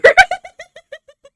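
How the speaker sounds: sudden high-pitched cackle; high burst of laughter. Real, not acted. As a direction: break into a loud high laugh. Reaction laugh at the clip.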